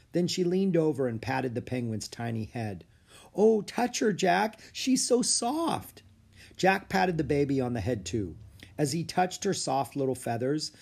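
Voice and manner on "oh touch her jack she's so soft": high, slow cooing delight. Acted. Annie urging Jack to pet the baby, high cooing.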